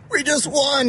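high-pitched, thrilled retold outburst; excited. Host imitating the hockey player phoning after the win, excited. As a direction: imitate a winner bursting with the news. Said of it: "he's so excited".

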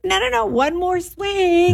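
high, stretched-out mock cheer; forced upbeat parent. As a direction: coax a crying child with put-on cheer. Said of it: "Try to keep him upbeat".

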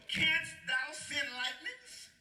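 slow, drawn-out question pitched high; grand, taunting scripture voice; God challenging Job. Acted. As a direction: stretch each word and lift the ending. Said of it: "He told Job".

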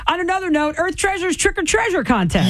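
high, bright contest announcement; promo announcer. Acted. Radio host announcing the station contest, upbeat promo read.

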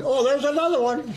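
high, excited pointing out; mock gawking. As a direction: naive wonder at the sky. Gawking at passing buzz bombs.

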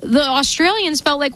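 normal talking voice

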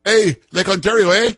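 high Canadian voice ending in eh; Canadian accent impression. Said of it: "spot-on impersonation of this guy Ford".

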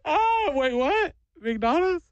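imitating a tearful kid, high-pitched, suddenly curious; kid stops crying. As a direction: play a wailing child perking up at a treat. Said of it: "I watched this kid immediately go".

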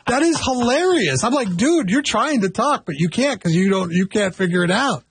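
squeaky with laughter; high laughing voice. Real, not acted. Laughing, high-pitched retelling.

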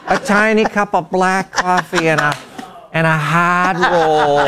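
aunt's drawn-out, disgusted complaint; complaining aunt. Retold or imitated. Host imitates aunt back from Italy, complaining about breakfast. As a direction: imitate an aunt unimpressed by her trip, dragging the last words. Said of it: "my aunt was like that".

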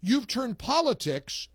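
host reads a listener's post to the audience, slow and pointed; reading a post aloud. Retold or imitated. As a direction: read the post like a scolding sermon. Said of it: "Isn't that a great post".

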